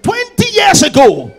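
high sung-out proclamation; mock triumphant testimony. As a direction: announce it like a grand revelation. Brother Moses reports old testimony.

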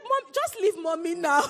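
put-on high, loud, teary child pushing mom away; sulky child. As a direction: whine it with a tearful laugh. Daughter sends mom away.

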